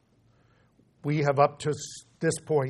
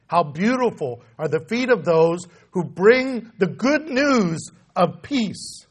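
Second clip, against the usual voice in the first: usual speaking voice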